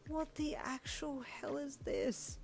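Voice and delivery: high, slow, soft disbelief; stunned hush. A narrator's reading. Stunned by the fancy room, hushed disbelief. Still whispering in awe.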